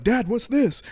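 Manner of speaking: put-on high, curious little-kid question; innocent kid. Son asking dad about a business card, innocent kid voice. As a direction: imitate a puzzled son, pitch lifted.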